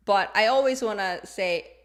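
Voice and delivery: ordinary talk, nothing marked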